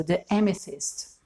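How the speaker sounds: ordinary talk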